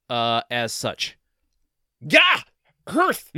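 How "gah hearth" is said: two loud, high bursts; big mock-dramatic holler; dramatic exclamation. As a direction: shout it as two separate outbursts. Announced two-word note, performed.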